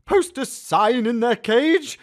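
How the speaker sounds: high, loud mocking retort; wife scoffing at her husband; sarcastic snap, somewhat quick. A narrator's reading. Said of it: "she actually said this sarcastically".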